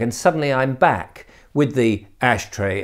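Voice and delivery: ordinary talk, nothing marked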